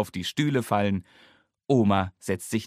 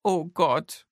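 wailing; 7 semitones higher than usual. A narrator's reading. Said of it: "jammert Mama".

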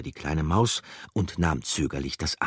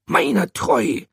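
very high, loud startled hamster cry; high squeaky hamster exclaiming; hamster's exclamation; 8 dB louder, slower than usual. Acted. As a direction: call out in sudden surprise. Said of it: "rief er".

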